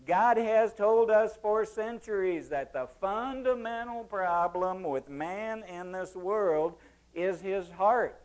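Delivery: ordinary delivery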